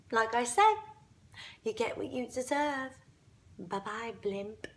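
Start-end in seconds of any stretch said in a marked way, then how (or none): 1.6-4.7 quiet, low parting jab; soft smug taunt. Acted. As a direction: say it softly, with smug finality. Smug farewell repeating whispered taunt.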